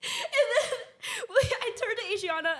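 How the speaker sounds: high, loud laughing voice. Real, not acted. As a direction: laugh through it. Laughing while retelling.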